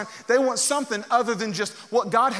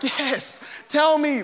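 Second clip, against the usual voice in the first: pumped; 6 semitones higher, much slower than usual. Retold or imitated. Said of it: "he was pumped right there".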